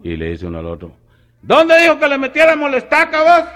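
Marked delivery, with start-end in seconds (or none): 1.4-3.4 very high, loud, bewildered shout. Retold or imitated. Villager asking his partner where the stake goes, very high and loud. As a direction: holler it up at your partner, lost.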